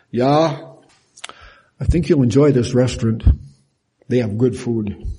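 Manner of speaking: a bored nod to a restaurant; unenthusiastic. Shown as a demonstration. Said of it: "They said it like that".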